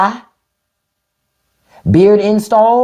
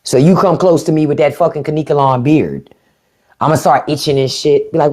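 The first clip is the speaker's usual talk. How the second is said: ordinary talk, nothing marked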